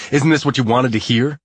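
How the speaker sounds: loud bitter taunt that eases off; heated bitter jab; sizzling anger. A narrator's reading. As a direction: sneer it at her in anger. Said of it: "Anger sizzles through me".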